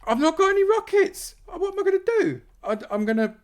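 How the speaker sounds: very high, loud, panicked wail; comic panic. Real, not acted. Streamer caught without any rockets, comic panic. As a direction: shriek it in a comic flap.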